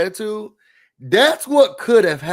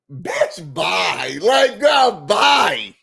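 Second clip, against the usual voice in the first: outraged shout; much slower than usual. Real, not acted. Host yelling at Kenya, outraged shout. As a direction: blow them off with a shout. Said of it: "own outrage".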